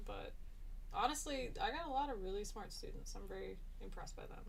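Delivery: usual speaking voice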